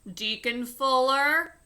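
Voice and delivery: loud, slow call across the church. Acted. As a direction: summon the deacon from the pulpit. Reverend calls across church.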